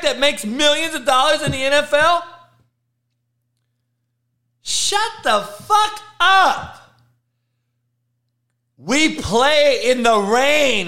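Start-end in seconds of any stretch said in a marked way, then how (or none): 4.6-6.8 slow, much higher outburst, drawn out; furious. Real, not acted. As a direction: let it rip, word by word, fed up. Host ranting at excuse makers.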